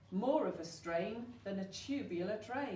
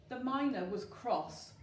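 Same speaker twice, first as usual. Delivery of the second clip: ordinary delivery, nothing marked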